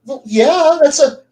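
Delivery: dim player. Retold or imitated. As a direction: play the simple-minded adventurer. Player answers the dragon.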